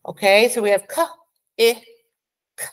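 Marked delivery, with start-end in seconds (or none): none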